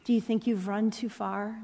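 usual speaking voice

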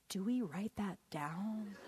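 very soft, slow, hesitant question; timid student. Acted. Girl in the front row to her teacher, timid. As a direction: ask it meekly, drawn out and hushed.

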